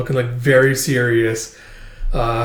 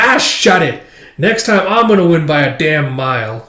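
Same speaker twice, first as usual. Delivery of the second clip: raised, loud acted snap; heated retort; 3 semitones higher, 5 dB louder than usual. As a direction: fire back hotly, pitched up at first. Acted angry retort in a quarrel.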